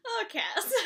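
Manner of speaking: high, laughing "Oh, Cass"; a fan melting at her favorite; fond squeal. Real, not acted. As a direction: gush with a laugh in the voice. Fan reacting to Cass quote.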